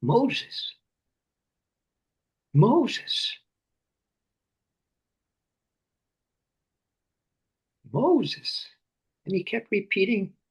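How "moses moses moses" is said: God calling Moses. Acted. God calls Moses from within the flame, a rising summons. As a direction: call across the distance to wake him. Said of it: "God says".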